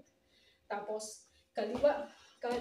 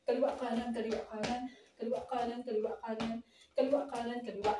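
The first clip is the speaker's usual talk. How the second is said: brisk, even dance count; lively-tempo drill count; quicker count; faster than usual. Shown as a demonstration. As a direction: keep the step calls moving in pairs. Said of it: "mas mabilis".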